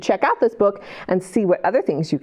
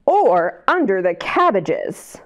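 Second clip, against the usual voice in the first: put-on reading to children. A narrator's reading. Reads picture book aloud.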